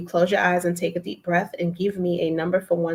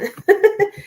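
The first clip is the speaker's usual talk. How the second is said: bright laugh; 14 semitones higher, 5 dB louder than usual. Real, not acted. A laugh after thanks.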